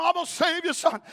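high loud promise; determined shout. Retold or imitated. Mother in tug of war shouting to her son. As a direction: shout it while pulling hard.

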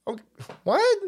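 high, baffled okay what; incredulous okay what. Retold or imitated. Blocked by Stu Peters, baffled high okay what. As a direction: react to getting blocked with disbelief. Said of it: "I was like".

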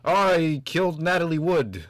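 half-hearted Walken line; Christopher Walken impression. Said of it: "Is that your Christopher Walken impression?".

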